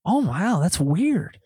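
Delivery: high, innocent-sounding mock amazement; feigned surprise. Prankster answering his victim Todd, feigned surprise. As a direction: play dumb with a lifted pitch.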